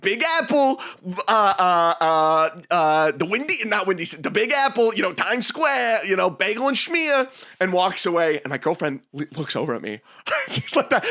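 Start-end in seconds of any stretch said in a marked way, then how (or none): 0.0-7.3 hammed-up New York waiter, pitched high; New York accent. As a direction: rattle off New York clichés in a put-on accent. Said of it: "he literally goes".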